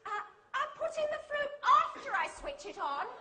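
high, fast and laughing, confused Jolene; puzzled British friend. Retold or imitated. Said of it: "She said".

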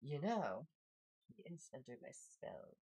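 soft, quiet snake lady threat; menacing snake lady. Acted. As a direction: purr the threat quietly, unhurried. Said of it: "She looks at you and says".

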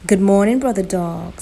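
loud, sweet-talking greeting; sugary. A narrator's reading. Spider greeting Brother Dog on the road, sugary. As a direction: lay on the sugary charm. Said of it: "in a sugary voice".

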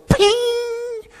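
high, drawn-out "ping"; imitated ribbon ping. Storyteller imitating a tight gift ribbon being cut, high ringing ping. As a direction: ring out a long high ping.

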